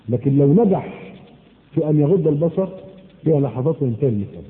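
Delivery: ordinary talk, nothing marked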